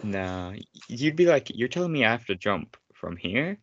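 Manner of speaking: ordinary delivery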